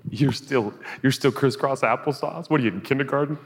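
high, teasing mock scorn; mocking taunt. Preacher mocking the seated believer, high and teasing. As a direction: look down on them like a smug kid.

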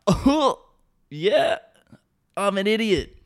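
high-pitched goofy self-mocking voice; silly high voice owning up; mock idiot. Acts out agreeing he's an idiot.

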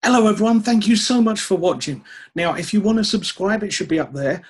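bright, loud presenter outro; YouTube outro. Acted. Outro to camera.

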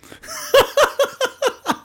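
high, loud laugh breaking out; laughing out loud at his own joke; burst of laughter. Real, not acted. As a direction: crack up mid-prank.

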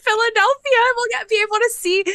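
high, loud, quick mock-tearful gush; overcome wailing. Host thrilled to see Joe again, overcome wailing.

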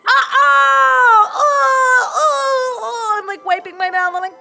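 high, loud, laughing shrieks of disgust; disgusted shrieking. Real, not acted. Player horrified after kissing corpse.

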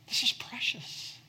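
high unvoiced whisper. Real, not acted. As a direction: breathe the words as a hushed whisper. Hushed climax of sermon.

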